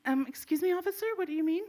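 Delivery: high, softened innocent voice; feigned innocence. Retold or imitated. Woman answers the officer, high feigned innocence. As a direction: pitch it up, playing innocent.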